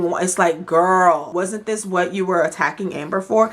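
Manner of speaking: ordinary talk, nothing marked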